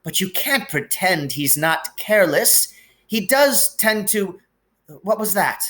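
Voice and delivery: put-on high, anxious older woman, voice on the edge of breaking; worried Scottish professor pouring out bad news; trembling McGonagall. A narrator's reading. McGonagall figure held.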